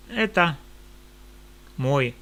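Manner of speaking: ordinary talk, nothing marked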